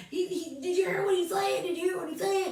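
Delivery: high-pitched mocking imitation. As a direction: mimic a sniggering onlooker. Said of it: "looked like they was mocking".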